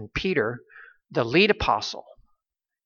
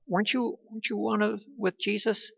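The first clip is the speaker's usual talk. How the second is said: higher, softer quoted question; accusing servant girl; 7 semitones higher, 6 dB quieter, faster than usual. Retold or imitated. Servant girl accusing Peter of being with Jesus, higher and softer. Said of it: "servant girl had accused Peter".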